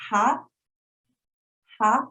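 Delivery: ha rising upward in pitch; teacher modelling the rising second tone. Shown as a demonstration. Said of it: "rising a little bit".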